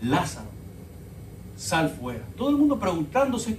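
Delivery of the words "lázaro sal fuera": low, hushed calling of Lázaro; a calm quiet summons at the tomb; quiet command. Retold or imitated. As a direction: say it softly, almost to yourself. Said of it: "simplemente dijo estas palabras".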